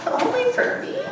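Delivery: high, rising amazed question; a small child's awe; amazed little cub. Acted. Little cub asking Mama in amazement.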